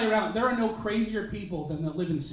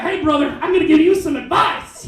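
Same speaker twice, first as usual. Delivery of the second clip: hipster bro; 8 semitones higher, 12 dB louder than usual. Retold or imitated. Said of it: "he's like".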